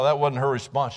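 ordinary delivery

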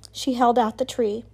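ordinary delivery

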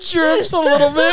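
laughing. Real, not acted. Cracking up at own joke.

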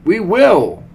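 high, loud pledge; excited followers in unison; eager cry. A narrator's reading. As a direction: shout obedience eagerly. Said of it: "they cried".

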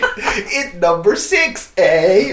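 put-on laughing, loose Canadian accent; hammy Canadian with a high eh. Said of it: "your Canadian accent is all over".

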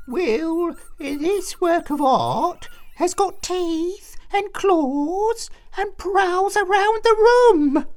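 slow, high, shaky mouse voice; frightened. A narrator's reading. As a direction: insist though terrified. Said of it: "shaking with fright".

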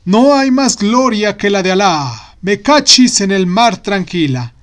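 proud caliph, declaiming loudly; booming ruler admiring his city. Acted. Caliph speaks to himself.

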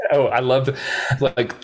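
usual speaking voice

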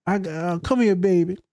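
put-on high, slow baby-talk coddling; mock tender soothing. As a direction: coo over a crying child. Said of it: "we be like".

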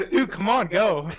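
loud, high begging with laughter; pleading mock shout. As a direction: imitate a desperate passenger. Acting panicked prankster urging driver.

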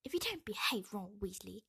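bossy sisterly telling-off; scolding threat. A narrator's reading. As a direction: scold a brother with a warning. Ginny threatening to tell on Ron.

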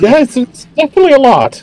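high, loud, sing-song welcome; sweet elf woman. Acted. Elf woman greets the returning twins, high cheerful welcome. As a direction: gush over the guests like a doting host.

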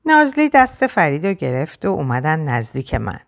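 ordinary delivery

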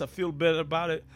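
ordinary talk

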